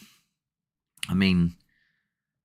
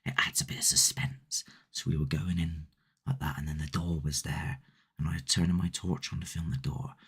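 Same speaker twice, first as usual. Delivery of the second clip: soft suspenseful whisper; storytelling hush for suspense; 7 dB quieter than usual. Acted. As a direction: breathe it like you're sneaking in. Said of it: "I'm gonna just do it as a whisper".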